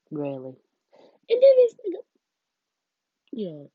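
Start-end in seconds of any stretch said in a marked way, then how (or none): 1.3-2.0 much higher and louder, bursting out; excited outburst. Real, not acted. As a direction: blurt it out in disbelief. Building to the shocking part.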